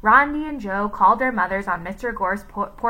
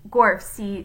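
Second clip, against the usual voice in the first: usual speaking voice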